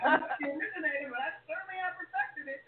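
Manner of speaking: laughing through the words; giggling boast, laughter in the voice. Real, not acted. Joking boast amid laughter.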